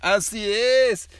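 high, loud chick scream; grito de pollito. A narrator's reading. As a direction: let out a shrill burning scream. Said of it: "¿Cómo gritó?". (grito agudo y fuerte de pollito enchilado)

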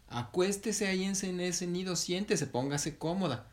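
high, insistent fussing; urging. Acted. Monkey mother settles heron in the nest, urging. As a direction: usher her along in a high voice.

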